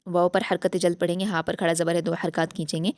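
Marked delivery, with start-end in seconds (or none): none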